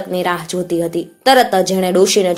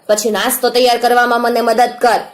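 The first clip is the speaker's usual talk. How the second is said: high, raised voice driving out orders; bossy old woman; 5 semitones higher, 3 dB louder than usual. Acted. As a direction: rouse and hustle the girl like a bossy old woman. Old witch rousing girl with orders.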